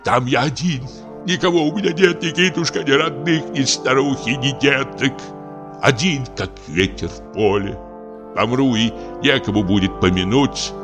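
put-on lonely old man's high, pitiful lament; plaintive outpouring that sinks into desolation; grieving, self-pitying old actor; frenzied despair. A narrator's reading. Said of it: "в каком-то исступлении".

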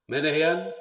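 booming. Acted. Hausbesorger holds forth in court.

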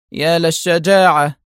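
dryly mock his bravery; sarcastic. A narrator's reading. Wife scoffs at her husband's confession, sarcastic. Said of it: "غمغمت في سخرية".

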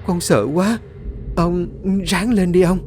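high-pitched frightened grandchild; panicked. A narrator's reading. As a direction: plead anxiously with an injured grandfather. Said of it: "hoảng hốt nắm tay ông lắp bắp run rẩy". (hoảng hốt, van nài giọng cao)

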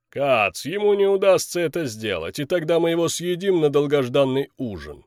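two bickering giant voices. Acted. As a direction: gloat like a giant about dinner. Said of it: "двухголовый великан".

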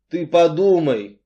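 firm appeal with mild sadness; persuasive and sad. A narrator's reading. Artyom appeals to Cain, persuasively and sadly. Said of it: "убедительно и грустно говорил Артём".

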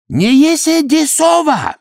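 high, slow, pompous broken Russian; self-important. A narrator's reading. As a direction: puff up and boast. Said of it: "важно отвечал Ходя".

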